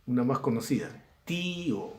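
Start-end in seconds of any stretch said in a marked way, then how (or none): 1.2-1.9 "tío" with a high, long stressed í; hiatus in tío. Shown as a demonstration. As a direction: draw out TÍ, then a separate o. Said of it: "Vocal cerrada con tilde".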